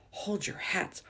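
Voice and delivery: hushed warning whisper. A narrator's reading. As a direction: breathe it out so she can't hear. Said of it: "Hortensina whispered".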